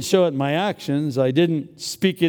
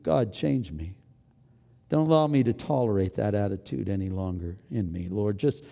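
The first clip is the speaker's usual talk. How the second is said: lower, softening, sincere plea; earnest prayer; 6 semitones lower, 5 dB quieter than usual. Acted. Preacher modelling a repentant prayer, low and sincere. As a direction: pray it humbly, fading at the end.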